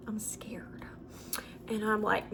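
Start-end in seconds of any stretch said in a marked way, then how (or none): 0.1-0.9 hushed scared. Real, not acted.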